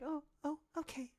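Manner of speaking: high, soft, cute imitation; innocent scholar. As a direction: mimic a sweet innocent scholar, quietly. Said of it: "kinda innocent scholar".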